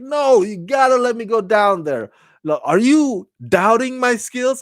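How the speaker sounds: high, indignant mock plea; Saru insisting. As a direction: act out Saru demanding to go. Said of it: "or I'm paraphrasing".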